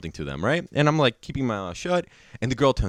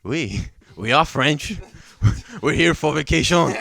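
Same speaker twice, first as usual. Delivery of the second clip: put-on French accent. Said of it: "we're talking like that".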